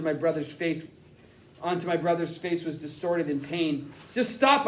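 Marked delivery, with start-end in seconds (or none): 4.1-4.7 higher, a pleading shout. Acted. Boy shouting at the man hurting his brother. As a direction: cry out to make him stop. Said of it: "I shouted".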